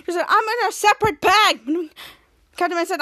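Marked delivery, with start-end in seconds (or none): 0.3-1.6 higher, louder protest; acted exasperated comeback; exasperated retort. Sidekick answering his accuser, exasperated.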